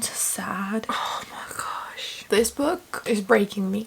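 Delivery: sad breathy whisper, barely voiced. Real, not acted. Heartbroken reader whispering to viewers about the book. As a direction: let it fall to a whisper, overcome. Overwhelmed by the book's ending.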